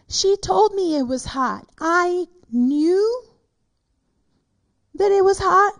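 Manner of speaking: ordinary delivery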